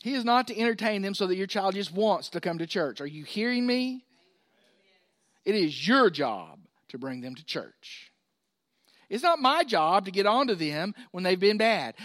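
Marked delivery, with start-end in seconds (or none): none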